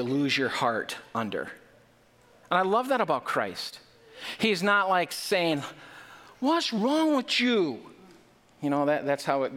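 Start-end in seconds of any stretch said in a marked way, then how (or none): 6.4-7.8 put-on high, slow, brash New York accent; scolding New York accent; New York Jesus. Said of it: "if Jesus was in New York, that's how he would talk".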